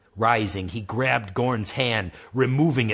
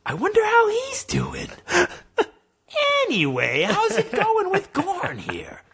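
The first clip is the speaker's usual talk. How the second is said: put-on old mentor Granlo, chuckling, pitched up high on the question; lightly voiced old mentor. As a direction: kind old sage, amused and curious. Granlo's voice in story.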